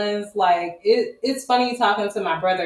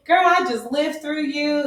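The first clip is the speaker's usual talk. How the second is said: mom's voice; 7 semitones higher than usual. Retold or imitated. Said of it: "My mama was like".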